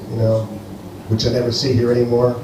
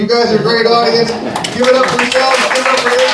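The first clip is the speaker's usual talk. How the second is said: bright rising sign-off, higher pitch; hyping the crowd; 10 semitones higher, faster than usual. Real, not acted. Comedian closing his set, hyping the crowd, higher. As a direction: pump the audience up as you leave.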